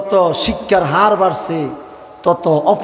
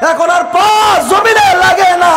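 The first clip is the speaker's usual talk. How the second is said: fiery preaching; 11 semitones higher than usual. Acted. Preacher warning the congregation against arrogance, shouting high. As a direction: whip the crowd into a frenzy of preaching.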